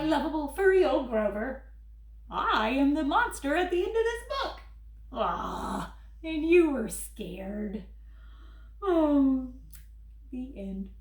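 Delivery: furry monster Grover, fretful and pleading. Acted. Grover reading his book to the kids, worried and pleading. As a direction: play lovable Grover begging the child not to turn pages.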